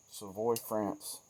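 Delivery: "Savoie, France" spelled out in sound, slowly, suh-VOY, falling; slow place name. Shown as a demonstration. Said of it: "with my redneck dialect".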